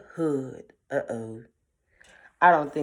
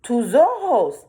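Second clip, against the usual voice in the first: higher, louder, slow; wary grandma; 6 semitones higher, 6 dB louder than usual. A narrator's reading. Grandma eyeing the wolf, slow and loud. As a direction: say it slowly, suspicious.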